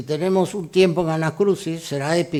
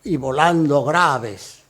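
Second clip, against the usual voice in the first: slow and louder, opening the a in "volando graves"; open a sounds; 4 dB louder, slower than usual. Shown as a demonstration. Lecturer showing the flight in the a sounds, slowly. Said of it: "las aes del vuelo".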